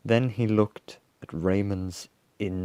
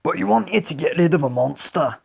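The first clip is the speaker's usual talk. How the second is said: high, loud warthog voice; animated, raised warthog voice; warthog character; 8 semitones higher, 6 dB louder than usual. A narrator's reading. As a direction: press the point as a storybook warthog. Warthog character addresses the monster.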